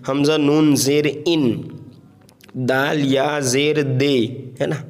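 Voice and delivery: letters named slowly one by one; slow patient spelling drill. Shown as a demonstration. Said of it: "हिज्जे करके पढ़े".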